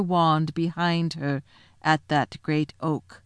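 slow, measured royal pronouncement; grave, deliberate lion ruler; stately lion king. Acted. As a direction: speak with unhurried authority. Aslan pronounces to the dwarf.

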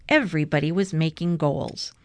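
ordinary delivery

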